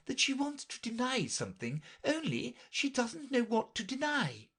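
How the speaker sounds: high, fretful voice. A narrator's reading. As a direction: fuss about the girl.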